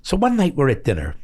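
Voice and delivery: ordinary talk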